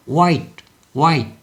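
no breath of H before 화이트, just W; silent H. Shown as a demonstration. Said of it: "요런 소리를 내야 되기 때문에".

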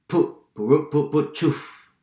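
high, loud rhythmic tractor puffs; strong, punchy motor imitation. A narrator's reading. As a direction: chug loudly as the tractor nears. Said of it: "El sonido se hizo más fuerte".

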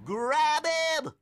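very high, drawn-out yell; urgent cry. A narrator's reading. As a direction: shout for your friend to catch him. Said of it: "cried George".

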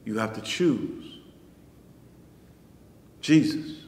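normal talking voice

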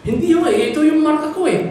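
put-on high-pitched childlike protest; insistent boy. Boy arguing with the toy seller, insistently. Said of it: "Sabi niya".

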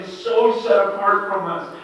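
normal talking voice

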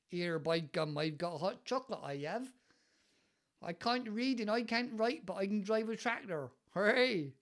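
hammed-up West Country farmer; West Country accent. Shown as a demonstration. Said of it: "my West Country accent creeping out there".

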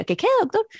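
high-pitched, playing dumb; feigned cluelessness. Patient pretending not to hear her doctor, high and innocent. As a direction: jump up high as if you missed it. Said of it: "yo me hacía la que no vivía".

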